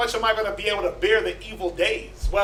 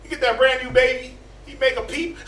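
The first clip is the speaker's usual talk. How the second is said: ordinary talk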